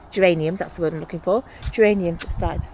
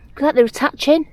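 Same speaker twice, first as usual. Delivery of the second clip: thrilled, high and loud; delighted discovery; excited; 8 semitones higher, 8 dB louder than usual. Real, not acted. Excited at growing loofahs.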